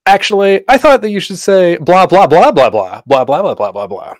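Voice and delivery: high, loud mock demonstration that settles down; bossy line reading. Director wrongly feeds an actor the line, mock line reading. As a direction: parody a director dictating the line in blahs. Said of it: "The one thing you shouldn't do".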